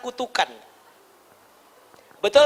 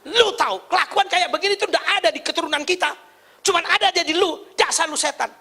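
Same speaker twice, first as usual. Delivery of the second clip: mock-angry retort, pitched higher; acted marital anger; 6 semitones higher, faster than usual. Spouse hitting back in a quarrel at home, mock angry. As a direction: hurl the insult back in a hammed-up quarrel. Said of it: "Istrinya marah begitu, suaminya juga balas".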